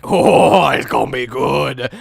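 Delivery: big loud gasp of anticipation, then settling; mock audience excitement. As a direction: imitate an overeager audience. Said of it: "you're already like".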